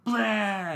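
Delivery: loud, high "blah" groan; mock drunk groan. Drunk Jonathan groaning at friends, loud and high. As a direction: blurt a sloppy drunk groan.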